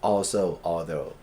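very slow, carefully spaced English words; slow model reading. Shown as a demonstration. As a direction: spell each word out slowly for the class. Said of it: "teacher models words slowly". (อ่านช้าๆ ชัดๆ ให้นักเรียนฟัง)

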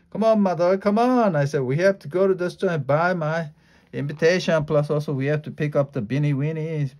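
put-on high, loud, eager child pleading; excited begging. Child begging mother to hurry.

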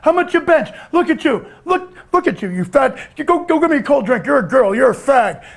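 high, strained big-guy boast; Bruiser Bedlam. Retold or imitated. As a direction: talk big in a pushed, high, monotone wrestler voice. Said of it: "this is how he would talk".

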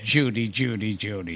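a hint of Cary Grant, fading away; Cary Grant impression. Host echoes the famous line in a light Cary Grant voice. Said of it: "Larry Storch that said".

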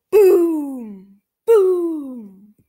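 loud rumbling boom; thunder boom. Acted. Thunder sound in story.

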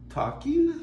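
high, questioning mermaid; light hopeful suggestion. Acted. Mermaid proposing talking, high and questioning.

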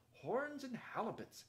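high, drawn-out cry of exasperation; acted outraged exclamation; indignant exclamation. A narrator's reading. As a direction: burst out with a slow, high-pitched oath. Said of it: "exclaimed the third voice".

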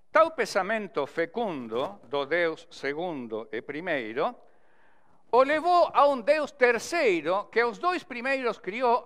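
verse recitation. Shown as a demonstration. Teacher reciting his poem about Cantor's gods, declaimed verse building in force. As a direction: recite rhymed cordel verse with a rising sweep. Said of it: "recitando para vocês".

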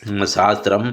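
ordinary delivery

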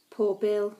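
ordinary delivery

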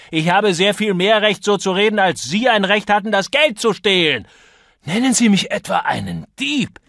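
very high, loud indignation; furious outburst. A narrator's reading. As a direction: rail at your opponent in outrage. Acted shouting match about theft.

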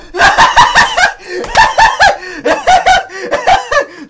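gloating laugh. Real, not acted. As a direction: cackle over your goal. Gloating after a goal. (schadenfrohes Gelächter)

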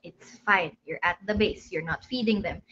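calming reassurance. Retold or imitated. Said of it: "Sabi ko".

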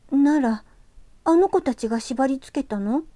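put-on young girl voice. ユキが男の子に尋ねる.

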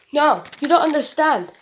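ordinary talk

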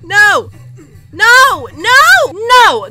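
loud high noes, breaking into a long scream; real screamed despair. Real, not acted. Gamer loses the last round, screamed despair. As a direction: scream no as victory slips away.

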